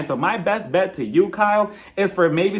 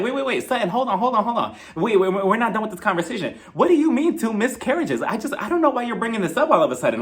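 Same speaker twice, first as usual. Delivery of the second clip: imitated confrontation, pitched up; insistent interruption; 4 semitones higher than usual. Kyle jumps back in to challenge Sutton, insistent interruption. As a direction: butt in and refuse to let it drop.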